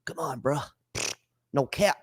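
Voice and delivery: usual speaking voice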